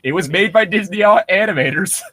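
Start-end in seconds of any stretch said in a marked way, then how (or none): none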